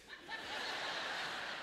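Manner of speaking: breathy burst of laughter. Real, not acted. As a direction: laugh it out at your own joke. Laughs at own bear joke.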